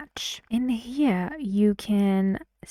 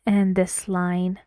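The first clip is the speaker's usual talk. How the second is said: usual speaking voice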